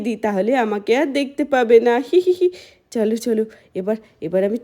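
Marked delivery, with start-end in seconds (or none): 2.1-2.5 mischievous giggle. Acted. Child hiding giggles to herself.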